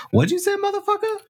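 high, fast mock threat; fast hammed-up snap at the pet; mock tough comeback. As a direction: play the offended mom.

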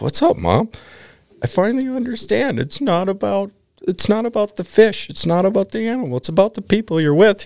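mocking high brother voice. Brother teasing mom by parroting her, high mock voice.

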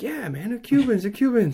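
high-pitched, eager mock street seller; imitated cigar hawker. Beach seller assuring a tourist they're Cubans, high mock-hawker voice. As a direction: put on an over-agreeable hawker's voice.

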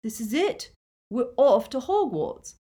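high, eager excitement; exhilarated. A narrator's reading. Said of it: "exhilaration in his voice".

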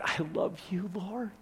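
woman at Jesus' feet, softly adoring; tender whisper. Acted. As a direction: breathe it to him, overwhelmed. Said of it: "that she said".

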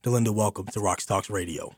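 normal talking voice

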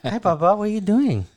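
pet-talk voice. Retold or imitated. Host greeting his dog Bubba, high cooing pet voice. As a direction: coo a question at the dog. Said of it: "go into dog voice".

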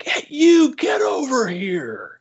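high, loud, slow summons; erupting call. Retold or imitated. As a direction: bellow it across the convention floor. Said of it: "just, like, erupts".

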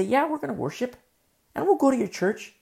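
high-pitched imitation of willingness; mock agreeable band. Worship band agreeing to visit a church, mock agreeable.

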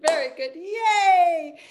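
high, slow, loud cheer. Shown as a demonstration. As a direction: cheer the group on with a big yay. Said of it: "cheer after exercise".

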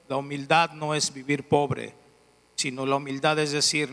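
normal talking voice

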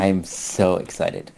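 slow, soft deadpan; mock excitement. Host answering "you sound pumped", deadpan mock excitement. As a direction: drag it out with no excitement. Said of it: "my very excited voice".

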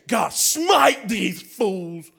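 drawn-out, hammed-up cry for smiting; mock vengeful prayer. Preacher imagines vengeful prayer from the cross, mock wrathful cry. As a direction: call down wrath in long, theatrical words.

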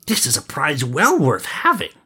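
high, satisfied prince voice; pleased prince. Acted. Prince admiring his prize, high and pleased. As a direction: lift the pitch with pleasure.

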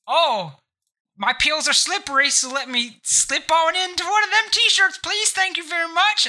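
high, silly cartoon voice; hammed-up goofy banana; a man in a banana suit asking for a t-shirt; banana suit guy. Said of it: "banana suit. And I'll be like".

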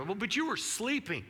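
mock the disciples' whiny excuse. Preacher voicing the disciples answering Jesus, lightly mocking. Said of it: "And I can just hear".

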